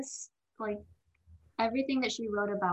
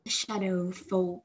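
slow, drawn-out imitation of an actor; Ben Barnes; much slower than usual. Fan quoting a favourite actor's line, imitating slowly. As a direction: stretch the words out in a put-on voice. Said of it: "Every time I hear Ben Barnes saying".

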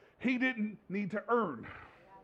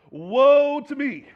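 loud, slow, drawn-out wail of woe; anguished cry; 3 semitones higher, 10 dB louder, much slower than usual. Acted. Isaiah crying out in God's presence, loud anguished wail. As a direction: cry out in despair before God.

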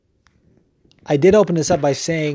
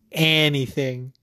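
usual speaking voice

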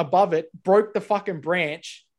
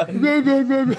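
swollen-lip speech; 9 semitones higher, 5 dB louder than usual. Retold or imitated. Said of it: "when he's trying to talk".

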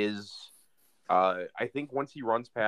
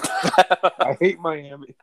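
higher, louder, slow laughing echo; laughing mock repeat; 6 semitones higher, 7 dB louder, much slower than usual. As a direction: laugh it back slowly, teasing. Repeats friend's complaint mockingly.

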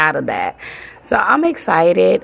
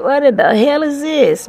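higher, amused disbelief in a put-on voice; mock disbelief; 9 semitones higher, 3 dB louder than usual. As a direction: toss it up like you can't believe your eyes. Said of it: "'cause I be like".

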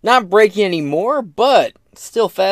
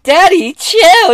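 much higher, loud, falsetto sass; mock sassy woman; 8 dB louder, slower than usual. Host voicing a woman telling Biden to relax, high and loud. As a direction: tease him like a bratty girl. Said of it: "she was like".

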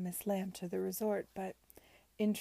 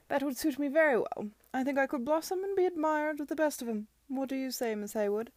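loud, higher, jovial gentleman; hearty Arthur, eager; 6 semitones higher, 8 dB louder than usual. Acted. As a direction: pipe up loudly with cheerful enthusiasm.

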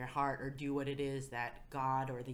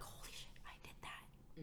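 hushed, amazed whisper to oneself; awed whisper; 15 dB quieter than usual. Acted. Speaker voicing amazement at what they pulled off, awed whisper. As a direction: whisper it in private disbelief. Amazed private thought, acted as inner voice.

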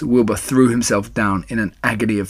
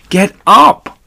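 high, very loud command; angry scream; 10 semitones higher, 10 dB louder than usual. A narrator's reading. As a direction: scream at a lazy shirker. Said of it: "screamed Wilbur".

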